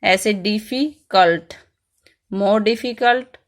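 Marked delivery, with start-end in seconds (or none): 0.3-1.5 difficult said slowly in two parts, डिफी, then cult; split in two beats. Shown as a demonstration. Teacher giving another two-part word to students, difficult split in two beats. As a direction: break difficult before its last syllable. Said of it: "जब दो बार में इसका pronunciation होता है".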